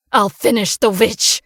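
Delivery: growled threat. Acted. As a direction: threaten to finish her off. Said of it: "growled Dmitri".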